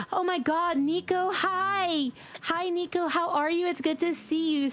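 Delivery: high-pitched, gushing hello; delighted greeting. Real, not acted. Streamer greets Nico arriving in chat, delighted. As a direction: greet them with a burst of high excitement.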